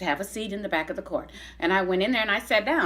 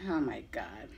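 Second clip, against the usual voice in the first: breathy laugh; 8 semitones lower, 15 dB quieter than usual. Real, not acted. Laughs at her little letter.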